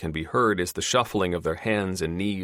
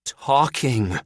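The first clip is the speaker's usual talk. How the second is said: snarling; 7 semitones higher, 5 dB louder than usual. Acted. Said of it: "Doctor Probst snarls".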